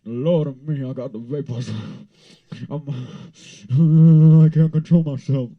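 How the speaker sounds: hammed-up, loud swooning; mock swoon. Seeing the singer shirtless, hammed-up swoon.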